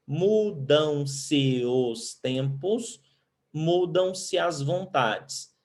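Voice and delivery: very slow, syllable by syllable; slow scansion. Shown as a demonstration. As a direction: read the verse to count its syllables. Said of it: "Tira a última sílaba tônica". (leitura lenta do verso, sílaba por sílaba)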